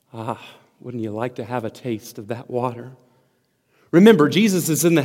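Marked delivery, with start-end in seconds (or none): 0.1-2.9 low, hushed sigh of longing; quiet, wistful question; soft, savouring aside. Real, not acted. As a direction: drop right down and gently invite them.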